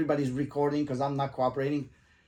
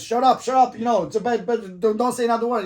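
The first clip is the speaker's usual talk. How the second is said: higher and louder, repeated "shut up"; angry shouting; 7 semitones higher, 6 dB louder than usual. Retold or imitated. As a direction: snap at him to stop talking. Cop repeatedly tells him off.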